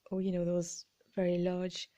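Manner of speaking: ordinary talk, nothing marked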